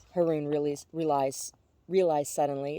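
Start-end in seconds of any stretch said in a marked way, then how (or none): none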